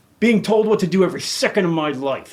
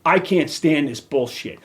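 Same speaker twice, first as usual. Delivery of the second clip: usual speaking voice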